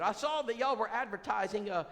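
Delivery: mild imitation of a woman caller; caller's voice. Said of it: "She said".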